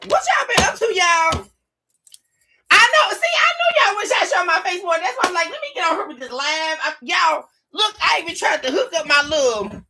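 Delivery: excited chatter. Real, not acted. Excited about showing her face.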